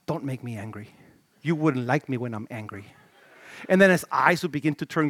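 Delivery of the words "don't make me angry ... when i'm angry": low, soft, menacing warning; quiet threat before the storm; Bill Bixby quote. Retold or imitated. Pastor quoting Bill Bixby's calm warning, low and hushed. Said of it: "he would look at them and say".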